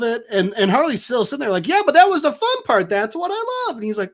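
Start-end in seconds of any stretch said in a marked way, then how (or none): 1.7-3.8 high, excited put-on voice; mock gleeful protest. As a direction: burst in squealing, hammed-up. Voicing Harley gleefully interrupting.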